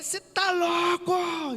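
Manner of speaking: high, drawn-out shout; angry yelling. Retold or imitated. Retelling how he yelled at the driver, drawn-out angry shout. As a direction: yell it high, stretching the words. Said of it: "e eu gritava".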